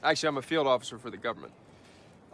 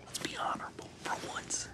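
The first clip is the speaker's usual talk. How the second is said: very soft, hushed protest; reluctant whisper; 6 semitones higher, 12 dB quieter than usual. Acted. Refusing to cheat against a rival, soft whisper. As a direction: plead quietly under your breath.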